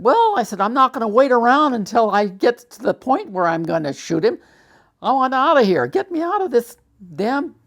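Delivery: high, loud and heated, pleading to get out; furious outburst. Retold or imitated. Soldier to chaplain, begging to leave the army, high angry shout. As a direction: let the anger burst out, loud and high.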